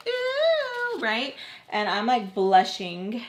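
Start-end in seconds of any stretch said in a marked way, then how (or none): 0.1-1.0 high, long-held ooh; teasing ooh. Retold or imitated. Everyone watching her blush, teasing high ooh. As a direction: imitate onlookers' teasing ooh. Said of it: "So everyone's like".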